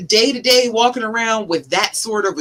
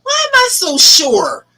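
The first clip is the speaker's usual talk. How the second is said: high, urgent self-interruption; halting oneself; 10 semitones higher, 4 dB louder than usual. Acted. Stopping a fearful thought in its tracks, urgently halting. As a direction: cut in on your own thought, pitched way up. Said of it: "You need to stop yourself and say".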